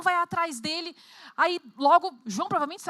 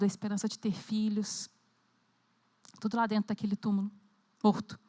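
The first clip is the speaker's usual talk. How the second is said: guided meditation; 7 semitones lower than usual. Acted. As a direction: lead them gently into the scene. Guided imagination of the tomb scene.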